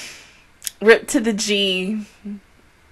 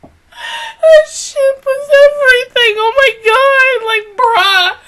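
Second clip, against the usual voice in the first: high, slow squeal of laughter; laughing so hard it hurts; laugh-crying; 6 dB louder, slower than usual. Real, not acted. Reacting to the long pause, laugh-crying. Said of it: "Oh, I'm crying".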